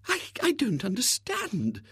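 high, faltering lady's voice; baffled spinster. Acted. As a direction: falter like a bewildered old maid. Miss Rubina baffled.

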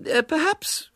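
high, prim lady's voice; a polite spinster forcing kindness. A narrator's reading. Miss Milton to the disguised boy, high and prim.

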